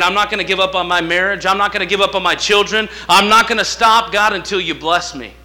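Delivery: loud, determined declaration to God; defiant vow. Acted. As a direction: swear it out loud, unbreakable. Vow voiced for the congregation.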